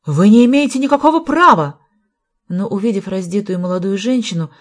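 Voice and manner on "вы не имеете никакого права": high, loud indignation; angry protest. A narrator's reading. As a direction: protest furiously at full voice. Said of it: "гневно говорил Фарвелл". (гневный громкий протест)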